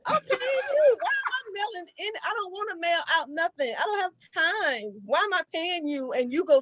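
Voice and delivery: high-pitched exasperated complaint; incredulous, fed-up protest. Real, not acted. Complaining to the audience about having to mail the letters herself, high and exasperated.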